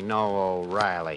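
low, slow, flat retelling of the pun; low, slow, dry repeat of the joke; deadpan repeat. Shown as a demonstration. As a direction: say it back dryly, unimpressed. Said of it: "Archie repeats the joke deadpan".

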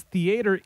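"theater" as thee-AY-ter; alternative theater pronunciation. Shown as a demonstration. Host reports dictionary verdict, "theater" as thee-AY-ter. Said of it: "acceptable alternative pronunciation".

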